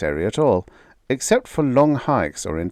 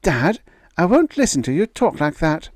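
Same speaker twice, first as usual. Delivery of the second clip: put-on higher, earnest young daughter; a daughter defending her fiancé, pitched higher; young woman's voice; 9 semitones higher, 4 dB louder than usual. A narrator's reading. Daughter defending Gregrill to her father, higher young voice.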